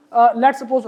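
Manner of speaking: ordinary talk, nothing marked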